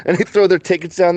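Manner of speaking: ordinary talk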